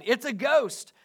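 fearful cry. A narrator's reading. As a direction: read as a restrained frightened outcry. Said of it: "they said, and cried out in".

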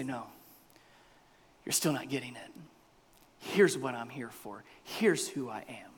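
low, soft, firm refusal; quiet firmness. Acted. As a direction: hold your ground softly. Alternative firm answer from Jesus.

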